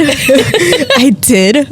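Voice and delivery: laughing admission. Real, not acted. Admitting to her cohost she cried, laughing.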